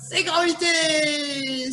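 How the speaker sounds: slow, high shouted announcement of the answer; host proudly calling out the answer to viewers; triumphant reveal. Real, not acted. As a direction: draw it out like a game-show reveal.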